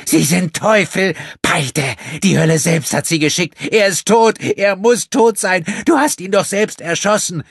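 high, loud, hate-filled cry; pure hatred. A narrator's reading. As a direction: spit out the words in hatred. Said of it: "nur blanker Hass".

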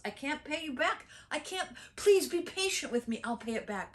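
high, acted begging; pleading servant. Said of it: "the servant pleaded and pleaded and pleaded".

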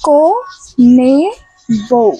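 খুব ধীরে, শব্দের মাঝে থেমে; কোনে... তারপর থেমে বউ, ধীরে বলো; slow. Shown as a demonstration. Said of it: "আবার স্লো, স্লো।". (হেডফোন পরা সঙ্গীকে আবার স্লো করে বলা)

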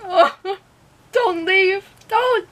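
very high, tearful plea; tearful pleading. Real, not acted. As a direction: beg them to stay while fighting back tears. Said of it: "I'm trying not to cry".

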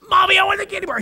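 mock tantrum building, very high voice; crying little girl. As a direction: imitate a toddler insisting through tears. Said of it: "this little girl begins to cry".

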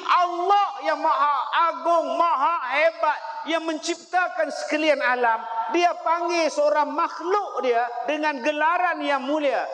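high, intense sermon peak; fiery preaching. Acted. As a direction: proclaim it with fervour. Preaching climax. (ceramah berapi-api dengan suara tinggi)

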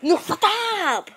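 high, loud hammed-up scolding; mock outrage. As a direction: scold them in exaggerated indignation. Scolds the other for interrupting.

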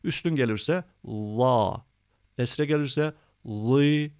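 "la" and "li" drawn out slowly; slow Quran letter drill. Shown as a demonstration. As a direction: read "la", "li" slowly like a reciting teacher. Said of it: "Şöyle okuyalım o zaman".

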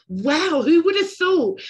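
loud, high-pitched wonder; amazed exclamation. Real, not acted. Host marvelling at shared experiences with guests, amazed exclamation. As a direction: burst out with open amazement.

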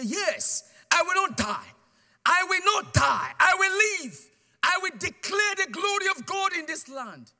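high, loud, defiant shouting; bold shout. Retold or imitated. Bound to a tree, the preacher shouts his faith in defiance of death. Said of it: "I became very bold there".

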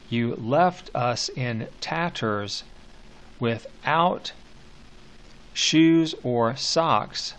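slow, measured verse reading with long pauses; slow poem reading. Shown as a demonstration. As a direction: read each line slowly, letting it land. Teacher reads poem lines aloud.

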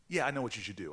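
ordinary talk, nothing marked

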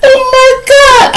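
very high, slow woman's voice; shocked girlfriend. Retold or imitated. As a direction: stretch it out, squealing in disbelief. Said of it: "She like".

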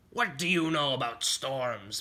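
scornful pirate challenge; demanding pirate captain. Acted.

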